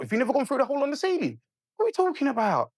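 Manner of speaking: imitating a high-pitched, incredulous reply; mock disbelief, pitched well up; retold incredulity. Storyteller acting the exchange about the hole in the ceiling, incredulous.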